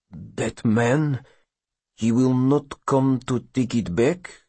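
put-on nervous question from an accented father. A narrator's reading. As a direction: ask it anxiously, careful and halting. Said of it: "the father asks nervously".

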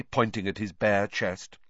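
ordinary delivery

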